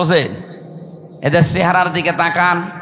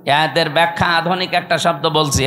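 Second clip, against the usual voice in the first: ordinary talk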